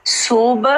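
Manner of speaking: सुब said slowly with a distinct final b; careful isolated सुब, the b fully sounded; clear "b". Shown as a demonstration. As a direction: finish सुब on a clear, separate "b". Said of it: "बा clear हो".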